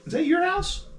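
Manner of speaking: very high, startled question; a jumpy clerk blurting it out; squeaky alarmed NPC voice; nervous librarian. Acted. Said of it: "he goes".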